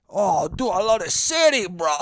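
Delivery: loud, badly faked Italian accent; goofy Italian accent falling apart; failed Italian accent. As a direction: botch an Italian tourist, loudly. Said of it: "I can't even do a fucking Italian accent anymore".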